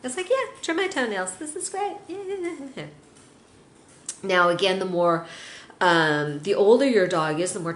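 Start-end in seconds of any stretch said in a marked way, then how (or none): 0.3-2.8 much higher, fast, delighted pet; happy pet voice. Pretend. Owner voicing a pet enjoying its nail trim, high and gleeful.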